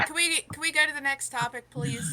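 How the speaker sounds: loud, fast, fed-up begging; exasperated plea. Real, not acted. Begging to change topic.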